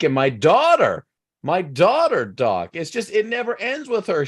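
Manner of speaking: a put-upon moth, pitch rising into desperate pleading; moth getting worked up. Acted. Moth pours out family woes.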